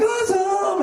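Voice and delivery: high gushing tourist; mock-thrilled lady squeal; gushing awesome. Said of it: "She's like".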